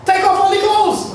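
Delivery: very high, loud order; urgent yelled instruction; retold shouting. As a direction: shout the command across the yard. Workers ordering them to strip.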